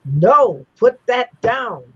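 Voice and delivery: slow, high, firm command from an unseen voice; lightly voiced spirit, each word slowed; stern warning voice. Unseen voice stops a young girl eating the sugar cube, slow stern command. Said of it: "then I heard distinctly".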